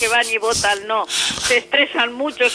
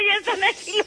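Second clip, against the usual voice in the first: high-pitched mock fright; squeaky playful alarm; 10 semitones higher than usual. (susto fingido y agudo)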